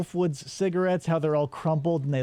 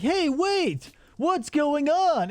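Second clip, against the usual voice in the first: startled call; 10 semitones higher, much slower than usual. Retold or imitated. Jeff calls after the TV woman.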